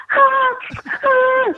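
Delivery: high wailing sob, imitated; hammed-up high crying ah; mock crying wail. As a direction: wail like a girl about to cry. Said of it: "and she just starts to cry".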